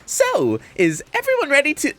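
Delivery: high, bouncy mascot bear voice; Monokuma voice. Acted.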